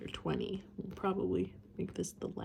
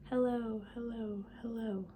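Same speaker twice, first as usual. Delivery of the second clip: echo; 4 semitones higher than usual. A narrator's reading. The mountain echoing the prince, fading away. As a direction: let it die away like an echo off rocks. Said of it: "the echo answered".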